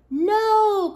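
high, loud, drawn-out refusal; crowd yell. A narrator's reading. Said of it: "the crowd yelled".

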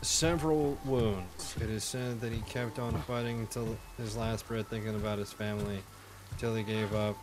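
soft, quiet solemn read; solemn memorial reading. Acted. Gamer reading a soldier's memorial, quiet solemn voice. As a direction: read the death notice gently.

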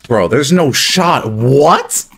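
high, loud disbelieving shout; shocked yell. Real, not acted. Disbelief after being beaten.

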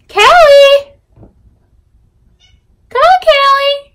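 very high, sing-out summons; calling out. Real, not acted. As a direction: call a pet over in a high, loud voice.

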